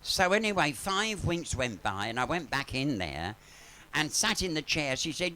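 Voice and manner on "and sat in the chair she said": ordinary talk, nothing marked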